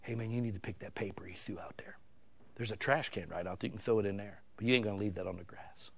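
hushed, unvoiced whisper to a kid; a stern whisper right into the boy's ear; whisper in his ear. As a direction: lean in and whisper firmly so his friends can't hear. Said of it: "I just went up to his ear".

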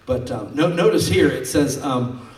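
usual speaking voice

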